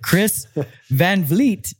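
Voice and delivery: slow, drawn-out club emcee intro; hammed-up strip club emcee; mock announcer. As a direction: announce her like a stripper onstage.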